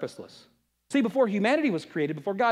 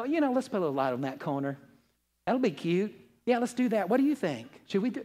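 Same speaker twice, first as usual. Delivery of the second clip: lightly mocking, softened poetry voice; mock wishy-washy little voice; mock passive; 4 dB quieter than usual. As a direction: imitate a timid, tentative suggestion. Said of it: "some little passive little poetry voice".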